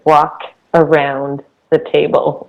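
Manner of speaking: normal talking voice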